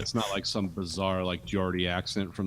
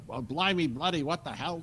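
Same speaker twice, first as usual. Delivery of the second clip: higher, mock-indignant British accent; old-school British accent; 8 semitones higher than usual. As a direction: ham up a stuffy old British officer accent. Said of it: "he sounds like the old school British guy".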